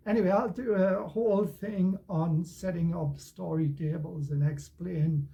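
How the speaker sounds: usual speaking voice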